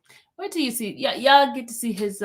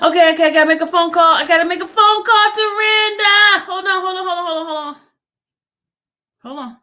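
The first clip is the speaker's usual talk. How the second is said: high, loud fast hurry; excited rush; 8 semitones higher, 7 dB louder, faster than usual. Real, not acted. Rushing off to call Randa, high excited singsong. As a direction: rush out the words, half singing.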